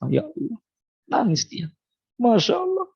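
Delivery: high, strained tearful cry of Allah; crying husband. Acted. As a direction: let the voice crack upward like weeping. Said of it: "sang suami menangis".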